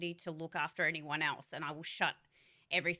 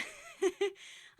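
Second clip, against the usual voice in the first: high laugh. Real, not acted. Laughing at the 7 AM call times.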